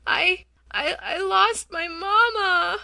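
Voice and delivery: put-on high, crying little child's wail, slowing down; lost baby wailing for her mother. A narrator's reading. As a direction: sob it out like a lost toddler. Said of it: "wailed the baby".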